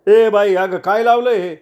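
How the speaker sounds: a councillor cuts her off, indignant; indignant retort. Acted. Lokre shouts back at her.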